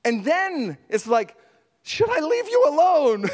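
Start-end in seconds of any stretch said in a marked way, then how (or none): 1.8-3.3 high, awkward retold question; mock hesitant offer, pitched way up. Preacher replays approaching a crying man, awkward high voice.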